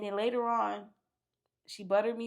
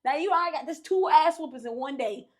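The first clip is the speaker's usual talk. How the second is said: ordinary talk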